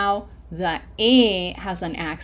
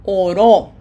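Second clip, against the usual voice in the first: "Oró" loud, weight on the last syllable; stress on final Ó; 12 dB louder than usual. Shown as a demonstration. Teacher introducing the accented word, final-syllable stress on "Oró". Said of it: "Without the accent mark".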